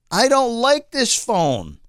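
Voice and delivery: high, slow, drawn-out complaint; real frustration. Real, not acted. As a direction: stretch it out in high exasperation. Rant about hated phone.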